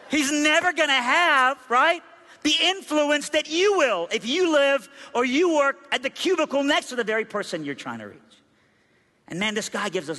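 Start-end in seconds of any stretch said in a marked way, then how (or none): 0.1-8.2 full-force preaching. Real, not acted. Preacher urging the crowd to reach people near them, forceful. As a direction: hammer the main point home.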